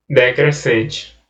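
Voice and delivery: slow, falling enunciation of Decrescente; slow demonstration. Shown as a demonstration. Teacher showing how the falling diphthong sounds, slow. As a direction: say Decrescente slowly with the pitch falling off. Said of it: "um som forte e depois um som fraquinho".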